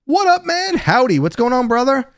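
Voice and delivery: light up as a buddy walks in; loud, high welcome to a friend who just showed up; excited greeting. Real, not acted. Greets a friend joining the stream.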